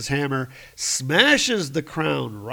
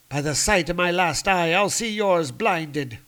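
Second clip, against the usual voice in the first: menacing warrior woman; 5 semitones higher, 3 dB louder than usual. Acted. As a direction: promise to blind him. Sif threatening Thor.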